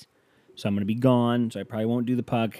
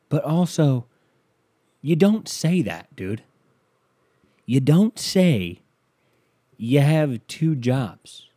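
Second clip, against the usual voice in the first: higher, louder, slow exasperated scolding; exasperated rant; 5 semitones higher, 4 dB louder, slower than usual. Real, not acted. To listeners about the driver, exasperated slow rant. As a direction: lay down the rule, exasperated. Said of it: "ranting at listeners".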